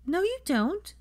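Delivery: put-on high, puzzled little boy; a child correcting granny, puzzled; confused boy. A narrator's reading. Teddy correcting his grandmother, confused child voice. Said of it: "said Teddy, confused".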